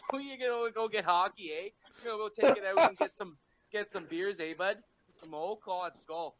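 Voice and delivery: put-on high-pitched comic Canadian accent; Canadian Wolverine. Retelling the actor's Canadian and Wolverine cross, comic Canadian accent. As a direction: imitate a Canadian-flavored Wolverine, with tossed-in "eh". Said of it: "cross between how a Canadian talks and how Wolverine would talk".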